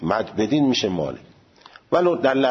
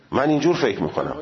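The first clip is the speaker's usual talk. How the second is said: ordinary talk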